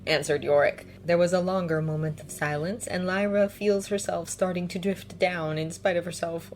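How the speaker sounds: plain reading voice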